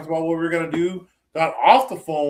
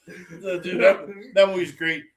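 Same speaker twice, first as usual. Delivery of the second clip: usual speaking voice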